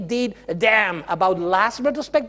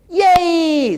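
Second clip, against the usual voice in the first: mock cheer; 7 semitones higher, 6 dB louder than usual. Adults cheering dropped ball.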